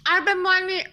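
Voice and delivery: talking through crunching ice; words around a mouthful of ice; chewing while talking. Real, not acted. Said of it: "I'm chewing ice".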